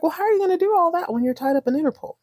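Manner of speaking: high, fast gloating question; spring the trap with a smirk; smug Richard. Retold or imitated. Richard taunting Pam, quick and high. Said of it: "Richard was like".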